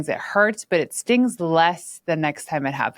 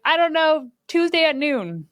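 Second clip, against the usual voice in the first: much higher, mock-innocent answer; a high, cheeky I don't know; cheeky retort; 10 semitones higher than usual. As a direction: toss off a sassy teen reply in a high voice. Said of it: "And I was like".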